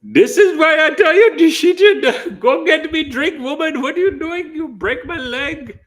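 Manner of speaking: high, loud put-on voice laughing through it; mock grumpy husband. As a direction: whine for a drink like a helpless old man. Acted grumpy husband demanding drink.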